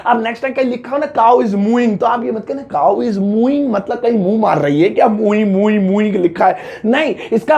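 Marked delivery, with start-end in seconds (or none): none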